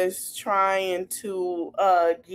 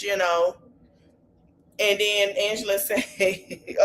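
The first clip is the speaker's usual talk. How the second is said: ordinary talk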